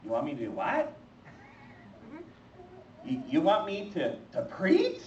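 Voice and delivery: can't believe what God just asked; stunned disbelief. Acted. Told by God to go preach: disbelieving, voice leaping high.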